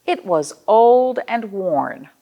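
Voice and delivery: slow, deliberate read-aloud; old and worn, read slow and sorrowful; slow storybook refrain. A narrator's reading. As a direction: draw out the refrain for kids.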